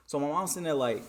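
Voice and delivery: ordinary delivery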